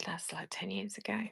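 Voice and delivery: embarrassed whisper. Real, not acted. Embarrassed by old photo shown.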